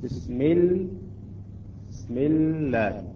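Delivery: بسم الله drawn out with a thin L; light lam. Shown as a demonstration. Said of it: "مرققة".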